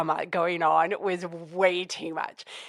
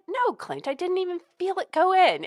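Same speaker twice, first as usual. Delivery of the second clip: exasperated reassurance; 10 semitones higher than usual. Retold or imitated. Retelling telling husband off.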